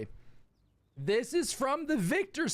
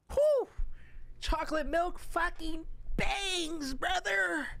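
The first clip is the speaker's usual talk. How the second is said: ecstatic; 8 semitones higher than usual. Real, not acted. Ecstatic over chocolate milk.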